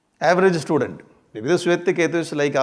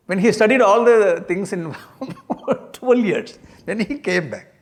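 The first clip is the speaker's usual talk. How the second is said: laughing; 3 semitones higher than usual. Real, not acted. Laughs while retelling.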